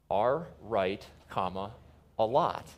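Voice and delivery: punctuation read aloud, with pauses round "comma"; slow and deliberate. Shown as a demonstration. Speaker repeating the principle for the audience, slow and deliberate. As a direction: dictate it piece by piece, low and careful. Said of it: "say it slowly and several times".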